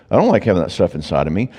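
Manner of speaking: normal talking voice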